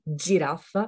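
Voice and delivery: « girafe » à l'italienne, attaque en dj; G italien plus dur. Shown as a demonstration. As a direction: dire « girafe » avec un dj initial, giraffa. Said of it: "c'est un G un peu plus dur". (« girafe » avec le dj italien)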